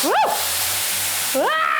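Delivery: high shriek into laughter; whoop, then shriek and laugh; shrieking jackpot winner. Real, not acted. Chili fumes hit her.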